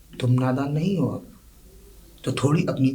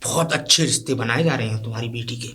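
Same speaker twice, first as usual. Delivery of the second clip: ordinary talk, nothing marked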